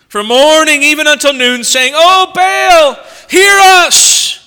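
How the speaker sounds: rising, pleading loud call; crying out to Baal. Retold or imitated. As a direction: cry out to a god who never answers.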